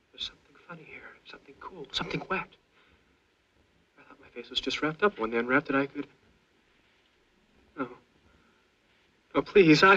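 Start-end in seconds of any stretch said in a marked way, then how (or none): 0.1-1.9 whispering. Acted. Inner voice whispering, sensing wound. 7.8-9.9 frightened plea. Acted. Sudden terror, pleading.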